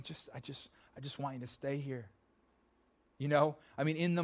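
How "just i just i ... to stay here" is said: soft, hushed voice of God pleading; gentle plea. Retold or imitated. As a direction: hush it, a tender request.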